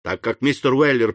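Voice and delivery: ordinary delivery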